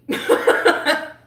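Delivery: high, loud burst of real laughter. Real, not acted. Streamer cracking up at own sleep joke, loud laughter. Joking about never sleeping.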